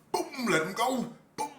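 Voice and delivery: high acted "boom"; punchy retold command. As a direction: boom it out, imitating the blow while near laughing.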